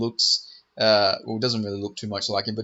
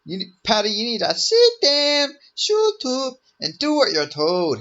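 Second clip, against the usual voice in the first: 1 Irish put-down; 11 semitones higher, 4 dB louder, slower than usual. Retold or imitated. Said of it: "he say".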